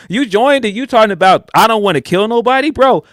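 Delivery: high, loud streetwise scolding; gang member. Retold or imitated. Gang member scolds Ospreay for refusing to fight, loud and high. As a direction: talk down to a new recruit like a gang member. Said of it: "it definitely felt like".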